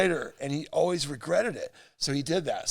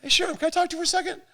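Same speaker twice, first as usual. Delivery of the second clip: high fast voice; 12 semitones higher, much faster than usual. Retold or imitated. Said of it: "He's like".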